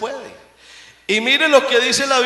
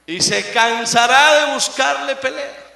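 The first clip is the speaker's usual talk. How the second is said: normal talking voice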